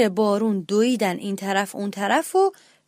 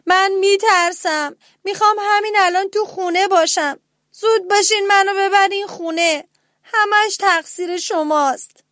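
put-on high, loud panicky child's cry; scared mouse shouts at his siblings to take him home; frightened shout; 9 semitones higher, 5 dB louder than usual. A narrator's reading. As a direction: yell it out scared and blaming. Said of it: "داد زد".

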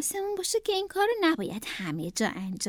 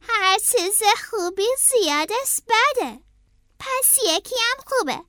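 high cartoon rabbit musing aloud; rabbit character; 8 semitones higher than usual. A narrator's reading. Howard deciding how many balloons, high character voice. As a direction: reason your way to more in a rabbit voice.

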